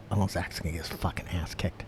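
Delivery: low, hushed aside; confiding. Retold or imitated. Retelling a fighter's private prediction, hushed and confiding. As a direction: drop it down like leaking a secret.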